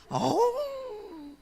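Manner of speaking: high, long howling roar; wife says it sounds like a wolf, drawn-out mock howl. Shown as a demonstration. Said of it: "늑대 소리".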